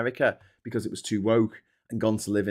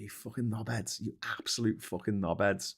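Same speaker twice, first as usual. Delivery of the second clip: muttered insult; 9 dB quieter than usual. Retold or imitated. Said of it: "looking at these stories going".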